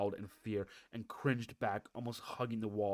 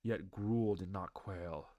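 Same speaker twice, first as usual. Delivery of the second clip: ordinary delivery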